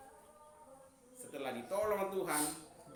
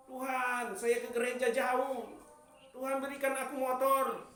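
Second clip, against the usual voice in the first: higher, louder whiny plea for a motorbike; begging prayer; 8 semitones higher, 6 dB louder than usual. Acted. Churchgoer begging God for a motorbike, mock whiny plea.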